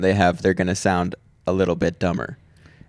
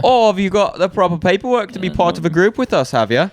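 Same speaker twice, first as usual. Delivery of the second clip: higher-pitched British official; mock British accent; 9 semitones higher than usual. British bureaucrat asking a newcomer for group paperwork, mock British accent. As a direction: put on a fussy British accent. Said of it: "I like the British act".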